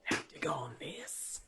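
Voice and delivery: soft whispered aside. Real, not acted. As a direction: whisper it to yourself. Aside to himself.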